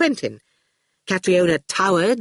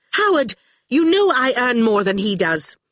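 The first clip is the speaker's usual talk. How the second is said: higher, emphatic protest; insistent appeal; 6 semitones higher, 3 dB louder than usual. A narrator's reading. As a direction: appeal indignantly for support. Catriona appeals in the row.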